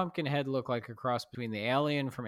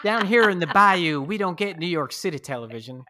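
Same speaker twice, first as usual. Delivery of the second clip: joking she's from the bayou, mock Southern voice; mock Southern local; 6 semitones higher, 10 dB louder than usual. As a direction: play the bayou local for laughs. Joking about being a local.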